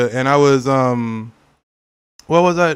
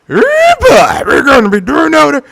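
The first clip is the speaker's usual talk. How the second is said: wild hollering mudder voice, pitched way up; hillbilly yell; 11 semitones higher, 7 dB louder than usual. Retold or imitated. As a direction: holler it like a yokel tearing through mud. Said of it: "I don't know why I did that".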